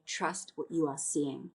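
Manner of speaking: slow, soft and calm, like leading a meditation; as said in her weekly group meditations, soft and slow; soft guiding voice. Shown as a demonstration. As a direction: gently coax the group to trust their eyes. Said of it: "I always say".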